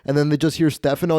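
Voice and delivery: usual speaking voice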